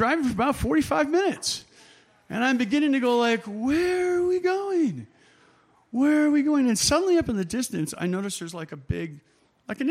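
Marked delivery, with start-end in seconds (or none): 3.5-5.1 high, slow, drawn-out worried voice; pitched-up fretful wondering; anxious question. Retold or imitated. Worried self in taxi, lost. 5.9-6.7 urgent worry. Retold or imitated. Repeats worry, more urgent.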